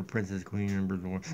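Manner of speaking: low, bored sarcastic mumble. Pretend. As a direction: roll your eyes saying it.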